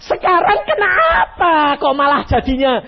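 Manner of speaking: high, loud grumbling at God; mock protest. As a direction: complain like Moses did. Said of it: "Kenapa Musa protes?".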